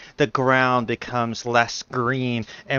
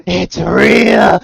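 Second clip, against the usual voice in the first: spooky taunt; 8 semitones higher, 12 dB louder than usual. Pretend. GM teases in spooky voice.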